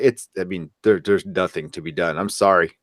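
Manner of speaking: ordinary talk, nothing marked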